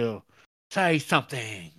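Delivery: loud, hammed-up British demand; mock British podcaster. Mock British reporter demanding the silent killer speak, hammed-up accent. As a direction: plead for an answer in an exaggerated British accent. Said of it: "these two intrepid British podcasters".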